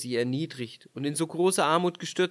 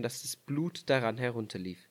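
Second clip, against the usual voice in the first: ordinary delivery, nothing marked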